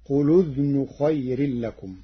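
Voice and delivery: قل with its lam carrying damma, qulu udhnun; Warsh reading. Shown as a demonstration. Teacher showing how the hamza's vowel moves onto قل. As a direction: join قل to أذن as qulu-dhnun. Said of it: "فتصير".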